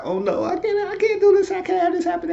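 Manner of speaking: high falsetto with a mock sob; Esperanza crying. Esperanza fretting over Steven, crying in high falsetto. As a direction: wail it in a high put-on woman's voice.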